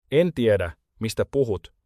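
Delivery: plain reading voice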